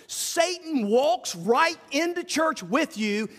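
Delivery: normal talking voice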